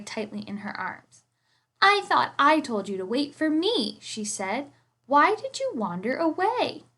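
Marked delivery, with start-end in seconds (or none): 1.8-4.0 gentle scolding. Acted. Scolding her bear. 5.1-6.9 gentle scolding. Acted. Scolding her bear.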